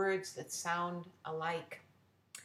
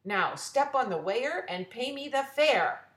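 loud, higher fed-up command; growled order; 5 semitones higher, 6 dB louder than usual. Acted. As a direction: bark it at her, fed up. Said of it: "growled Mister Bear".

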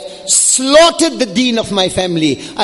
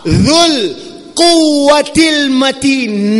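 "quwwatil mateen" drawn out very slowly; slow, resonant emphasis on "dhul quwwatil mateen"; emphatic recitation; 5 semitones higher, much slower than usual. Shown as a demonstration. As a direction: stretch "dhul" and "mateen" with heavy weight. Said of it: "Nine levels of emphasis".

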